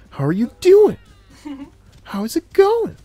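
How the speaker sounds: very high-pitched, loud mock greeting; exaggerated greeting. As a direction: squeal the greeting way up high, hamming it up.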